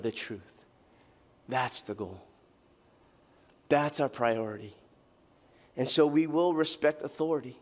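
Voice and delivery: low, soft sermon close; solemn scripture reading. Acted. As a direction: bring it down reverently. Reads scripture, closing exhortation.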